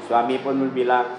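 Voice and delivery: ordinary delivery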